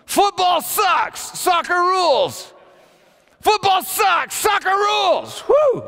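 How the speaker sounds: high, full-throated victory shout; yelling at full lungs. Retold or imitated. Teens shouting out of car windows at rival fans, yelling. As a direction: whoop and bellow it like a rowdy teen chant. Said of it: "yelling at the top of our lungs".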